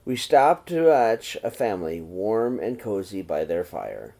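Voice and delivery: plain reading voice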